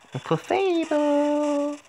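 very high, slow drawn-out call. Acted. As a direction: sing the name out high. Stretched high call of name.

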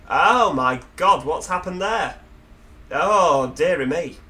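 loud, slow startled exclamations; alarmed. Real, not acted. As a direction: caught off guard by the drop. Sees sheer drop, scared of heights.

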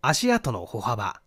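ordinary delivery, nothing marked

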